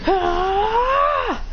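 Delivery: long high fighting yell; kick battle cry. Acted. Kick in the face.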